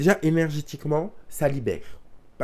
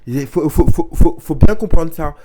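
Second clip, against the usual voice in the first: emphatic insistence; 3 semitones higher, 13 dB louder than usual. Real, not acted. Insisting hard on a point.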